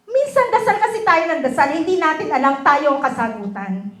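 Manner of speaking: loud, high preaching at full force; a fervent altar-call exhortation. Real, not acted. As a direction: exhort the congregation, building fervor. Closing exhortation to the congregation.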